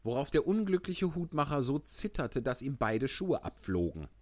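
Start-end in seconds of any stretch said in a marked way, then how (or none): none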